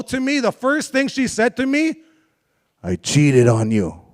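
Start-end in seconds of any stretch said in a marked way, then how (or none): 2.8-4.0 very low, slow, deep mock voice; real low. Girlfriend's confession on the phone, mocked very low and slow. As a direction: drop the voice way down, slow and dark. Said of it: "Oh, real low".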